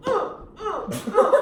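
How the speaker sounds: high laughing grunts for each step; kid's grunts. Retold or imitated. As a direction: imitate a kid grunting as he stomps.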